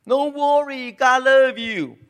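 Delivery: high, slow and sugary; high mock reassurance. Preacher mocking a false leader's promise, high and slow.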